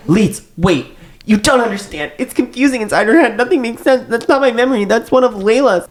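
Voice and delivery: high, panicked pleading. A narrator's reading.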